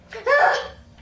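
sharp high gasp with a laugh; surprised gasp. Real, not acted. Sudden excited reaction to picture.

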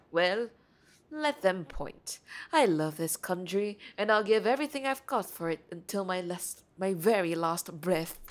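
earnest British foreigner in a story game; British accent. Acted. Blonde foreigner declaring love for the country, British accent. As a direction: play him British, measured and sincere. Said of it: "Oh no, he's British".

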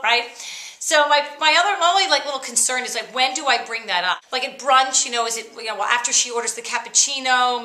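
usual speaking voice

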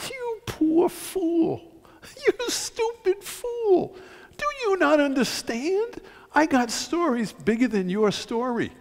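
high, drawn-out, pitying scorn; impassioned rebuke. Acted. Speaker addressing bin Laden about his story, impassioned rebuke. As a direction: rebuke slowly, voice pitched well up. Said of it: "I would say to bin Laden".

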